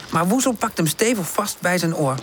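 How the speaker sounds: ordinary delivery, nothing marked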